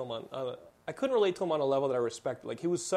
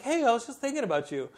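put-on higher, sweet girl voice; 9 semitones higher than usual. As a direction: play the girl teasing him. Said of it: "she's like".

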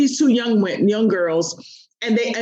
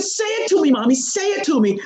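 put-on eager child; 7 semitones higher than usual. Daughter begs mother to repeat.

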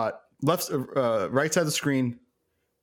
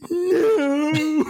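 much higher, louder overwrought cry; dramatic no; 6 dB louder than usual. Retold or imitated. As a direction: wail it like a hammy villain on his knees. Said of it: "so dramatic".

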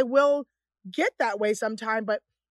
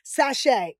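higher, punchy camp dance commands; imitating a sashaying cousin; flamboyant dance caller; 4 semitones higher, 7 dB louder than usual. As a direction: call out sassy runway moves. Cousin's flamboyant dance routine, retold.

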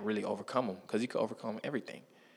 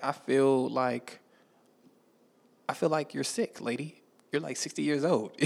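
slow, disbelieving high voice; incredulous; 4 semitones higher than usual. Retold or imitated. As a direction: react like you can't believe it.

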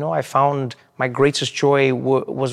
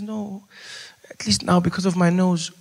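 higher, put-on mom voice; joking mom; 5 semitones higher than usual. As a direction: deliver her cheeky comfort. Mom's joke punchline.

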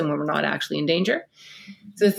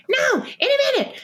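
put-on high, far-off whiny child voice. Four-year-old in the bath whining back at mom. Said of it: "a four-year-old won't get out of the bath".

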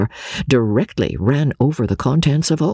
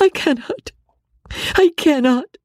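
very high, desperate wail of refusal; anguished cry. Acted. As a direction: wail it helplessly, high-pitched. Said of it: "cried Marianne".

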